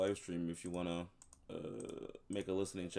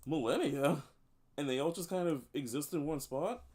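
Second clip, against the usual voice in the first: higher, louder echo of MILLENNIA; astonished, raised-pitch query; incredulous question; 7 semitones higher, 5 dB louder than usual. Acted. Echoes explanation in disbelief.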